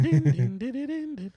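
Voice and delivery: high laughing chime sounds; ding like a little bell; ding chime imitation. Mimicking a robot chime, laughing.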